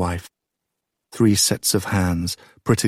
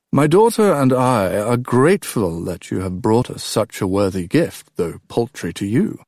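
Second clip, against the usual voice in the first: a cool, courteous king with a faint edge; a hint of a gracious king, gently mocking; lightly voiced King Tyndareus; 3 semitones higher than usual. A narrator's reading. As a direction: thank him politely while hinting at scorn.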